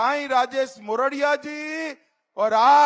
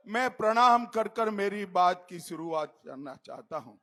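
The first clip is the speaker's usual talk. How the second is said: ordinary talk, nothing marked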